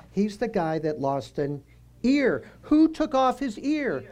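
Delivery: ordinary talk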